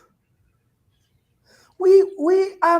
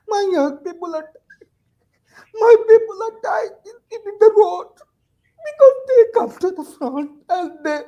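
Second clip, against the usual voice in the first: crying; 7 semitones higher, 6 dB louder than usual. Real, not acted. Panelist grieving for young protesters killed, crying. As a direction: voice breaking into tears, pitched up and louder. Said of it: "I'm very emotional now".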